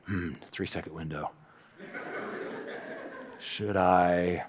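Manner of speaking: very low, hushed musing; mock inner deliberation. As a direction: ponder under your breath, hesitating. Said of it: "I wasn't like".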